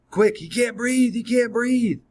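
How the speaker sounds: high, loud, urgent retold cry for help; boy calling the nurse in panic, frantic; panicked call for help. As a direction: yell for the nurse as if a friend is choking.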